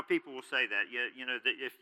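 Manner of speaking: ordinary delivery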